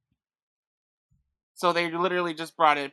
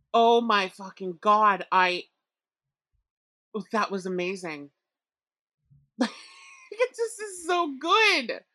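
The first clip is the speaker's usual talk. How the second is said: awed rave; 3 semitones higher, slower than usual. Real, not acted. Raving about the scene.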